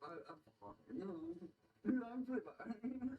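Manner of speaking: high, soft, slow mock stoner; wispy spaced-out answer; stoned guy. Replaying the high guy's answer, high and soft.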